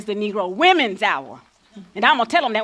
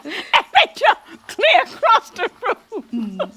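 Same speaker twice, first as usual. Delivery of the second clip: laughing hard; 13 dB louder, much slower than usual. Real, not acted. Laughing at the memory.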